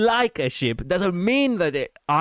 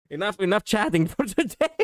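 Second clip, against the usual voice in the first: higher, giggly scolding; joking telling-off broken by laughter; laughing; 6 semitones higher than usual. Real, not acted. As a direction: laugh through a mock cutoff. Jokingly threatens to end the chat.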